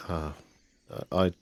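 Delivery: usual speaking voice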